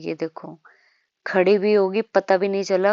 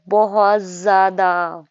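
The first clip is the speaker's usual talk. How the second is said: बहुत ज़्यादा loud and drawn out; बहुत ज़्यादा hammered slowly to stress a huge amount; emphatic exaggeration; 8 dB louder, much slower than usual. Real, not acted. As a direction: stretch both words of बहुत ज़्यादा slowly and forcefully. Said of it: "मैं express भी नहीं कर पा रही इस आवाज़ के through".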